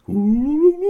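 loud, very high trilling "woo" greeting; alien hello. Acted. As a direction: warble hello in alien language. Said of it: "I'll just say hello".

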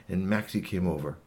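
plain reading voice

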